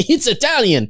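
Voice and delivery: high, huffy Italian insistence; indignant Italian. Retold or imitated. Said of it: "He's like".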